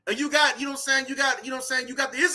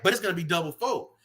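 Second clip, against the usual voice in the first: usual speaking voice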